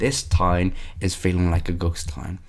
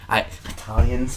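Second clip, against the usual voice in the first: ordinary talk, nothing marked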